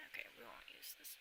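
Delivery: very soft, quick whisper; hushed throwaway note to self; whispered aside. Real, not acted.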